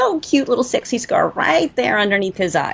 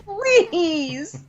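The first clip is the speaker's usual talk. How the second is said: incredulous; 6 semitones higher, much slower than usual. Real, not acted. Mocking the Strand scene.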